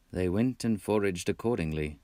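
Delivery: ordinary delivery, nothing marked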